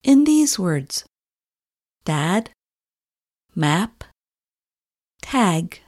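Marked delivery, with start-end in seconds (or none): 2.1-5.8 "dad", "map", "tag" with a wide, open short A, lips pulled back; short A demo. Shown as a demonstration. Teacher modelling "dad", "map", "tag" for Igor, with soft, careful short A. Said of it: "Try making this short vowel sound in these words".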